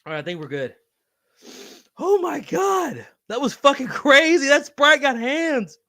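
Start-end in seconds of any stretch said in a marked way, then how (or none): 2.0-5.8 shocked disbelief. Real, not acted. Disbelief after choking.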